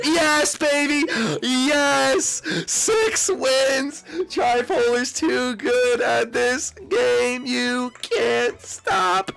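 high, triumphant drawn-out cheering; exultant high-pitched boasting, words stretched out; victory shout. Real, not acted. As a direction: crow slowly over a big win. Wins the sixth game.

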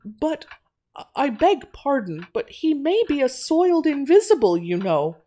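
high-pitched, halting objection; hint it timidly, with polite pauses; hesitant hinting. A narrator's reading. Quimby warning Natty about her unseen C, hesitant hinting. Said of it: "he hinted".

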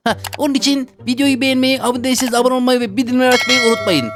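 put-on high kid voice asking to subscribe; kid voice outro plea. Kid character asking viewers to subscribe: high kid voice. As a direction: stay in the kid voice for the plug.

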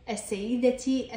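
السيدتي drawn out slowly, final ti; voweled t ending. Shown as a demonstration. Teacher voweling the word for students, السيدتي ending in ti. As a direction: end السيدتي on a slow, clear ti. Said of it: "عند تحريكها تلفظ تاء".

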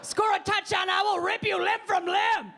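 a devil yelling in a player's ear, hammed up; dark lord voice. Dark lord yelling in the player's ear, mock demonic shout. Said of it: "I could hear him in my ear".

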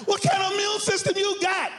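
strained high cry of disbelief; baffled Covid. Acted. Covid questioning its host from the corner, high astonished cry. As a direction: play the virus, high-pitched and astonished. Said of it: "covid was sitting in the corner coughing".

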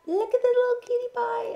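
high, loud cutesy squeal; squeaky adoring voice. Real, not acted. Cooing over the toddler.

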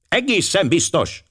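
high, cutting acted reply; very sharp. A narrator's reading. Wife to the girls urging her to come, very sharply. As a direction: cut them off in a high, sharp voice. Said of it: "hangja még élesebb lett".

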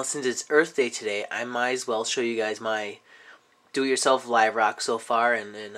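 ordinary talk, nothing marked

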